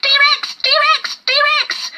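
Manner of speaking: very high, loud repeated warning; alarm call. A narrator's reading. Lizard warning everyone the tyrant is coming, shrieking. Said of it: "calling his warning".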